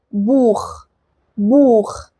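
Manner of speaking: "بوخ" as Buch, long u and throaty kh; German ach sound. Shown as a demonstration. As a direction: slowly model "بوخ" with back-of-mouth خ. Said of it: "دي بتتنطق خ".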